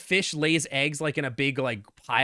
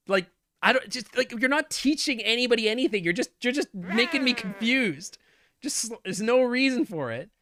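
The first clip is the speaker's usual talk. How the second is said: ranting; 6 semitones higher than usual. Real, not acted. Ranting continues.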